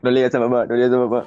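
lightly voiced older brother begging, half laughing; a hint of the sly brother's plea; mock pleading brother. Teasing brother mimics the plea to keep mom out, mock pleading. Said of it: "le decía lo mismo que decía tu hermano".

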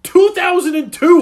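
loud high insistence; insistent shout. Real, not acted. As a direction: shout it back over him. Insisting against correction.

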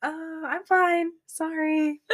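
high, slow reassurance after a scare; retold apology. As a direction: act out a small, apologetic "I'm okay". Retold reply to shaken roommate.

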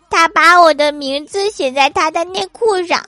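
put-on high, loud, childish indignant voice; angry little boy. Little son angrily telling mom about his brother, childish indignant. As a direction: spit it out like an outraged kid. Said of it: "小儿子生气地说".

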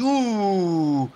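short howled ouh like a wolf. Retold or imitated. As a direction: give a quick wolf howl. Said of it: "fais le loup".

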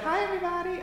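high squeaky chipmunk greeting. Retold or imitated. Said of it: "I sound like a chipmunk".

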